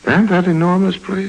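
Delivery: high, incredulous question; astonished. Acted. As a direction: voice jumps high in amazement. Astonished at the mansion.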